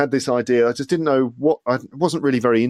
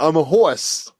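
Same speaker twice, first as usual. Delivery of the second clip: higher-pitched mock New Jersey accent; echoed New Jersey accent; 7 semitones higher, 4 dB louder, slower than usual. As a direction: play along, copying a child's New Jersey accent. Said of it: "this New Jersey accent's coming from".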